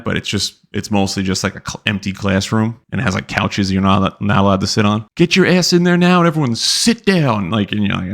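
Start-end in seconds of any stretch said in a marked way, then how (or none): 5.2-7.5 drill instructor yell. Retold or imitated. Drill instructor shouting recruits into the day room. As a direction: order them in like a drill sergeant.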